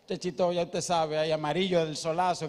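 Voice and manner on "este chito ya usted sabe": ordinary talk, nothing marked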